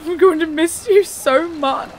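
high, loud, tearful farewell; crying goodbye. Acted. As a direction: break down as you say goodbye to your parents. Daughter parting from her parents.

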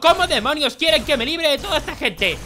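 loud, high, exasperated outcry; exasperated shouting. Real, not acted. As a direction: shout it in frustrated disbelief. Overwhelmed by zombie horde.

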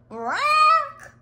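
very loud, high squawk; creature squawk. A narrator's reading. Orthocosaurus swoops at the egg, loud high squawk. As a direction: screech it out like a swooping pterosaur.

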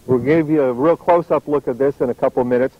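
normal talking voice

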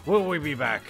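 usual speaking voice

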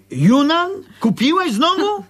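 high-pitched scolding, climbing to a final cry; exasperated, high-pitched telling-off; indignant outburst. Acted. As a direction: scold in outrage, pitch leaping up. Quarrel over buying Chinese things.

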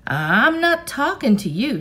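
high, loud refusal; shouted back. A narrator's reading. Girl shouting back at the boy chasing her. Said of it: "she shouted back".

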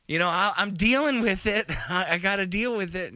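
high, loud mock of local woman; first lady. Host mimicking the first lady interviewed, high mock voice. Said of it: "She, she's like".

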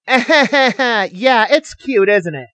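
high, sarcastic comeback; snarky retort starting high and falling. A narrator's reading. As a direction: answer with biting fake agreement. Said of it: "Manuel said in a snarky tone".

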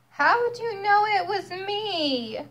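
high, plaintive turkey voice; a dismayed little turkey; whiny turkey. Acted. Caught turkey asks cow, high and plaintive.

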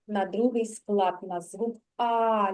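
ordinary talk, nothing marked

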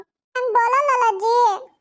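put-on high, whiny small-boy voice; little grandson. Grandson whining to grandma for chocolate, high and whiny. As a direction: plead like a spoiled little boy.

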